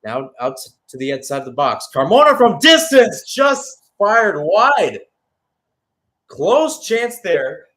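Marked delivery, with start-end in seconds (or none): 1.9-7.7 loud, high burst on the near miss; excited call. Real, not acted. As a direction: shout it as the shot flies wide, then drawn out. Near miss shot from distance.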